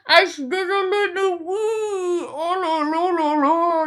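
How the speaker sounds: high, near-tearful gushing; half-sobbing with excitement; a fan too thrilled to hold back; overexcited. Real, not acted. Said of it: "je suis tellement excitée".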